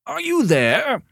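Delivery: high, loud call with a rising end. A narrator's reading. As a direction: call urgently down a telephone line. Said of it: "called Mister Morrison".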